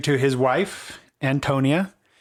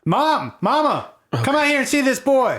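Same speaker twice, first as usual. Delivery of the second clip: calling out; 12 semitones higher than usual. Acted. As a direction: shout toward the farmhouse. GM voices farmer calling out.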